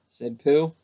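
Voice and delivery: ordinary delivery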